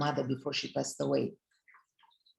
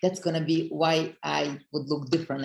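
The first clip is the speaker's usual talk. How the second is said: ordinary delivery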